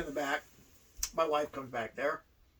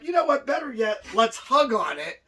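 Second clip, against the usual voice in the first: high, louder mock-friendly pitch; eager coaxing; 5 semitones higher, 5 dB louder than usual. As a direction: sell it like a brilliant new idea. Acted coaxing of gullible opponent.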